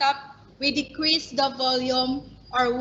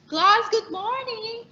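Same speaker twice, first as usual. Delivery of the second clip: much higher and louder greeting; high pitch demo; 10 semitones higher, 4 dB louder than usual. Shown as a demonstration. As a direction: sing out the greeting high and bright. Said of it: "A teacher may have a high pitch".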